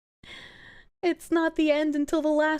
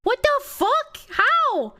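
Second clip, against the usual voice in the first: shocked outburst; 10 semitones higher, slower than usual. Retold or imitated. Reliving the alarm shock.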